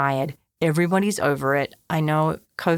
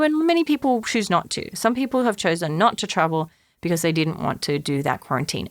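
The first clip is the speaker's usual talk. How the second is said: ordinary talk, nothing marked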